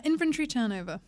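"inventory" run together in a British accent, almost "infantry"; sounds like infantry. Real, not acted. Guest gives the host her term, "inventory" sounding like "infantry". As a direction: say "inventory" with its middle squashed, British style. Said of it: "it sounded almost like infantry turnover".

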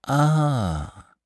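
long drawn-out, high "aah"; crowd's shocked "ah", held long; sustained "Ah". A narrator's reading. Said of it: "found voice in a sustained".

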